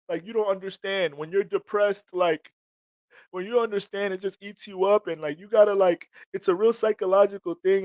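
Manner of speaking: high mocking whine, imitating the depressed; mock whiny voice. Mocking people who justify depression, in a high whine. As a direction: lampoon them in a high whiny voice.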